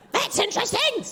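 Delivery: very high, strained cartoon duck; aggressive duck. Duck apologising for its voice, aggressive high squawk. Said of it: "said the duck".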